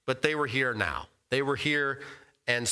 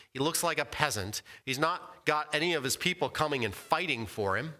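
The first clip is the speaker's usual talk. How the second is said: usual speaking voice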